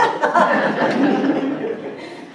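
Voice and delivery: a high, breathy laugh rising up; giggling high and airy. Real, not acted. Laughs at the joke again.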